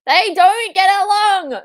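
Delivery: high, loud, slow delighted yell; real glee; excited shout. Real, not acted. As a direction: crow at the feuding partners. Partners fighting on screen.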